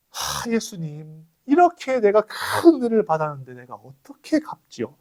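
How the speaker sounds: a hint of a lament, pleading upward; retold weeping prayer. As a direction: voice the leper's tearful thanks, pitched high. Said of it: "이러면서 막 통곡을 하면서".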